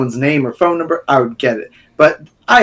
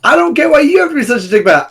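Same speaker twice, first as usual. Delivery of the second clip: much higher, fast sing-song parroting; mocking echo; 12 semitones higher, 4 dB louder, much faster than usual. Friend mimicking the co-host's complaint to mock him. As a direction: throw his words back in a silly mock voice.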